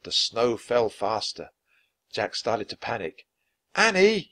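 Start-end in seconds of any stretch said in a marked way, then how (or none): 3.7-4.3 high, loud call for his sister. A narrator's reading. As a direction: call her name across the crowd. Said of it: "he called".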